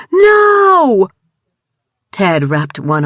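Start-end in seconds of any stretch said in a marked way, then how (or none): 0.1-1.1 very high loud sobbing cry of no; crying shout. A narrator's reading. Brian wailing as Dawn dies, crying shout. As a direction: wail it in despair.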